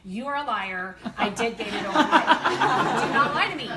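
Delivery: loud mock outrage, laughing through it. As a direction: scold the saying, laughing and loud. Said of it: "I'm like".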